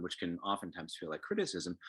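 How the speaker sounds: usual speaking voice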